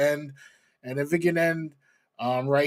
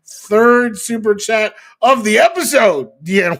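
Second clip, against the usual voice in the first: high, loud show announcer; announcer hype; 6 semitones higher, 8 dB louder than usual. Pretend. Host announces the third super chat of the episode, announcer hype. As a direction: boom it out like an announcer.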